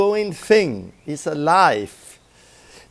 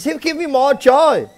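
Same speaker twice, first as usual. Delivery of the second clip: mock prosperity preacher; 8 semitones higher, 4 dB louder than usual.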